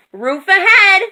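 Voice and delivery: high, loud lookout call; alarmed, high-pitched shout; shouted warning. A narrator's reading. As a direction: shout that danger is coming. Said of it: "Moo shouted".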